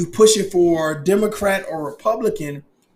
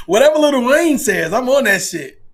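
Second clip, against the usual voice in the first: louder, higher mock eager follower; 5 semitones higher, 5 dB louder than usual. Mocking a fan who does whatever [name] says.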